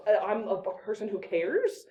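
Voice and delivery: usual speaking voice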